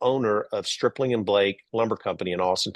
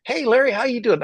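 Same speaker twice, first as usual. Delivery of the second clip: casual friendly greeting; 11 semitones higher, 3 dB louder than usual. Retold or imitated. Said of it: "and he's like".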